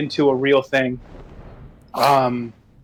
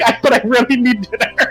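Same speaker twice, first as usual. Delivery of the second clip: whiny plea; 7 semitones higher than usual. Retold or imitated. Retelling desperate plea for chicken.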